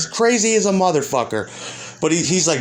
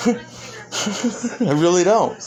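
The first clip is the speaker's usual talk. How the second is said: laughing; 3 semitones higher, much slower than usual. Real, not acted. Laughing at the critic.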